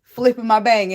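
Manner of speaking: usual speaking voice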